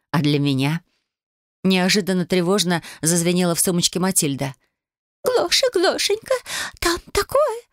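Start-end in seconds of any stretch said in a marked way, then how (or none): 5.3-7.6 high squeaky magic mirror voice; a tiny enchanted mirror, urgent and high; loud whisper, tiny mirror. A narrator's reading. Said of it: "громко зашептало зеркальце".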